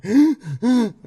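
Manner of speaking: loud, high, panicked gasp for air; sobbing gasp. Retold or imitated. Boy watching his choking aunt breaks into a loud gasp. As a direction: show how the crying started. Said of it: "empecé así".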